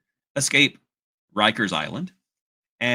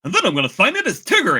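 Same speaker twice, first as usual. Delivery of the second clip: imitated voice actor; 7 semitones higher, 6 dB louder, faster than usual. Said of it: "he will just say".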